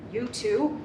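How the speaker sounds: ordinary talk, nothing marked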